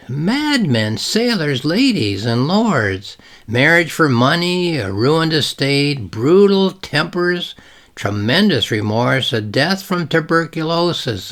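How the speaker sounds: each item lifted high, pausing between them; dramatic list reading. Acted. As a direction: read the list like a grand litany. Reads list from novel.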